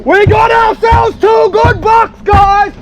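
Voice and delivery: high, loud, triumphant call; excited shout. Real, not acted. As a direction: shout the catch to the audience. Celebrating two bucks caught.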